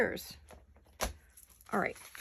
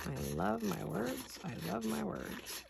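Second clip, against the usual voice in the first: slow, softer, savoring "I love my words"; dreamy softer repeat; 8 dB quieter, slower than usual. Real, not acted. Crafter murmuring to herself over favorite word cutouts, slow and soft. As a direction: croon it to yourself, slowly and softly.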